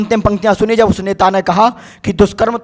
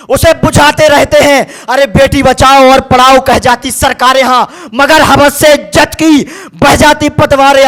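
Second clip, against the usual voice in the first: slow, raised-pitch declamation; forceful protest poetry; 3 semitones higher, 6 dB louder than usual. Acted. Poet demands punishment for rapists before a crowd, forceful declamation. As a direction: hammer out each line like a rallying cry.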